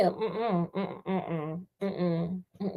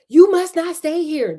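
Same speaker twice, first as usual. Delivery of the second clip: higher, louder urgent warning; brothers warning; 7 semitones higher, 6 dB louder than usual. A narrator's reading. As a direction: bark the warning like a worried brother. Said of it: "they said to her".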